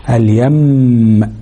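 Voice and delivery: اليم ending in a long hummed م; held ghunna. Shown as a demonstration. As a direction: hold the final م of اليم, slowly. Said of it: "أو على الميم المشددة". (اليم بغنة طويلة على الميم)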